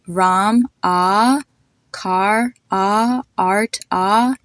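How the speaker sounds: "Ram, ah, car, ah, art, ah" with one long open AH; matching AH vowels. Shown as a demonstration. Teacher letting listeners hear the same ah sound, drawn-out AH. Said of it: "They have the same ah sound".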